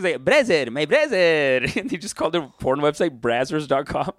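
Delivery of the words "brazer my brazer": put-on "brother" as "brazer" in a Lebanese Canadian accent, last word drawn out; imitated Lebanese Canadian greeting; slow falling "brazer", Lebanese Canadian style. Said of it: "with a Lebanese Canadian accent".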